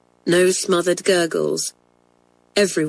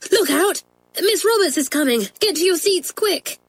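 much higher, hurried alarm call; urgent warning; 10 semitones higher than usual. A narrator's reading. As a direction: alert them in a panic. Doris warning teacher coming.

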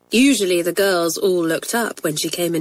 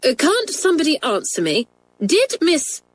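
higher, sharp demand cut off; teacher demanding an answer, sharp; 8 semitones higher than usual. A narrator's reading. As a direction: snap at the silent class. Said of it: "Miss Roberts spoke sharply".

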